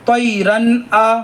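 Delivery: very slow drawn-out Quranic recitation. Shown as a demonstration. Teacher modelling for students, slow and drawn out. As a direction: stretch each sound like a tajweed teacher. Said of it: "teacher models recitation".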